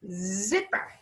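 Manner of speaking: "Zipper" with a long buzzing Z; stretched first sound. Shown as a demonstration. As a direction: hold the Z before finishing "Zipper". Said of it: "I hear the sound z".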